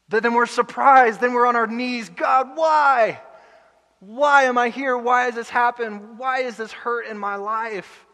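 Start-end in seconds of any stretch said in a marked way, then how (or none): 2.1-8.1 high, loud pleading cry; the fallen sinner cries out to God, high and loud; anguished cry to God. Retold or imitated. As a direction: plead with God from your knees. Crying out to God.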